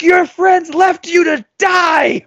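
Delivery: high, drawn-out taunting shout; acted full-voice menacing yell; retold scream. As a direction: scream it slowly at a cornered opponent. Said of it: "I'm, like, screaming at this kid".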